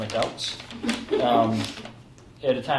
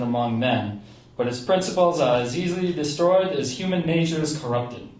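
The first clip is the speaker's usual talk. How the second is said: put-on Uncle Arthur's New England accent. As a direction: read the letter as a New England uncle would, with a hint of the accent. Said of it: "I figured I'd read this in his voice".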